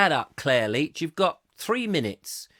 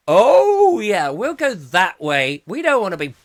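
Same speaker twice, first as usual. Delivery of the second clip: mock eager agreement; 5 semitones higher, 9 dB louder than usual. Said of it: "And we were going".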